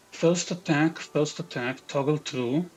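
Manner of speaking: normal talking voice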